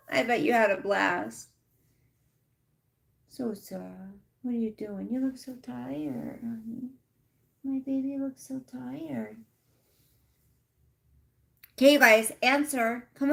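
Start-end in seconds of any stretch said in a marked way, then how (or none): 3.3-9.4 tender pet talk, softer and higher; cooing to the dog. Real, not acted. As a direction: coo over a sleepy puppy. Cooing at her tired dog.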